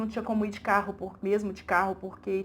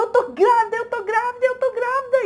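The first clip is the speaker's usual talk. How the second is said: high, loud, overjoyed cries of the news; ecstatic announcing; 12 semitones higher, 7 dB louder than usual. Retold or imitated. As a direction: shout it out to everyone, bursting with joy. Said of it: "em êxtase de choro e alegria". (gritando de alegria, em êxtase)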